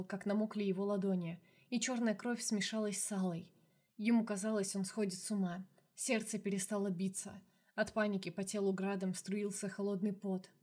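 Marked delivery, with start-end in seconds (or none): none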